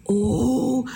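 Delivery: usual speaking voice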